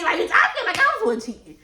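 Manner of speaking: high, loud, fast disbelief; indignant outburst. Real, not acted. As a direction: rant at the unfairness. Indignant at being blamed.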